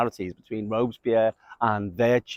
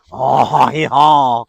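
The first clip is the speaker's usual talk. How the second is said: drawn-out, louder groan of indulgence; mock indulgence; 6 dB louder than usual. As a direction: groan it as if giving in to temptation. Mimes giving in to impulses.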